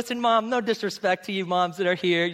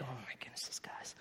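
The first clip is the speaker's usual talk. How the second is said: exasperated aside; 8 semitones lower, 20 dB quieter than usual. Retold or imitated. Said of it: "Jesus is like".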